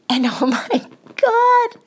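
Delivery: high, slow laugh of disbelief; incredulous laugh. Real, not acted. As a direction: laugh it out in shock.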